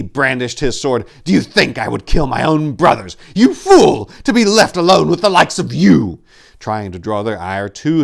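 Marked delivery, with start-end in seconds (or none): 1.2-6.2 high, loud scornful shout; loud enraged scorn; furious retort. A narrator's reading. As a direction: lash out with contempt.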